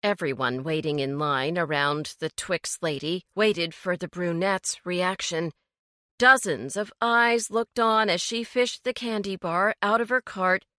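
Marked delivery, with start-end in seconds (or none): none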